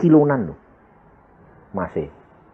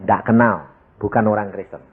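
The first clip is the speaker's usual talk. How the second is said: usual speaking voice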